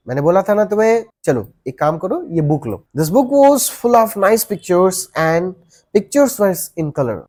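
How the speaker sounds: ordinary delivery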